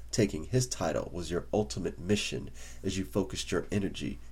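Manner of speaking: ordinary delivery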